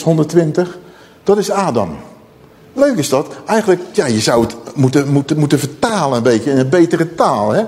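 usual speaking voice

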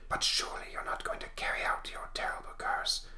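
hushed spectral whisper; voiceless, eerie whisper; ghostly whisper. Acted. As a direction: whisper as a ghost confronting a foe. Spectre's voice in the story.

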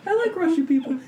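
high-pitched mocking imitation of a friend; teasing falsetto quote through laughter. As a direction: mimic her in a high voice, laughing. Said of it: "where she's like".

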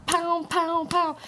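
high-pitched "pow" noises; imitated bursts. Telling how the dinner suddenly took off, high mimicked bursts. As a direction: fire off high, drawn vocal pops.

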